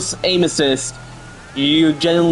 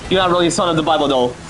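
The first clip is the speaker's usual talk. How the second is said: ordinary delivery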